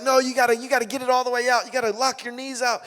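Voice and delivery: high, urgent coaching; pushing coach. Retold or imitated. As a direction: drive the player to finish.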